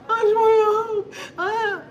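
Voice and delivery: high, loud drawn-out wailing; crying. Retold or imitated. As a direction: bawl it out through tears. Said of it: "crying like that".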